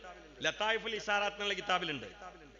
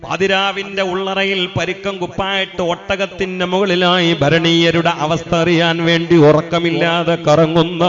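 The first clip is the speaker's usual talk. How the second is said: slow, much louder chanted sermon praise; a drawn-out, rousing sermon chant; full-force preaching; 26 dB louder, slower than usual. Acted. Chanted sermon tells Umar story.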